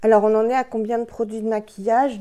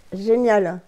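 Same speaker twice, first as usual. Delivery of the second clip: ordinary delivery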